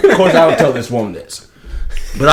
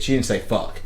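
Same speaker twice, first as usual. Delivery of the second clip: ordinary delivery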